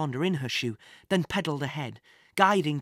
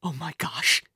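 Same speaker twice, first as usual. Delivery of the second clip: ordinary delivery, nothing marked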